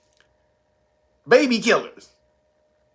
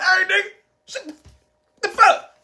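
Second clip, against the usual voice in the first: hushing shout; 13 semitones higher than usual. Retold or imitated. The insiders hushing their colleague, a high panicked shout. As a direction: yell at him to shut up, in mock panic. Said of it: "They be like".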